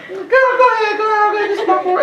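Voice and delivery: put-on high girly voice, playful; hammed-up girl urging him on; girl impression. Preacher imitating his date at the movies, high and girly. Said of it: "That's my impression of girls".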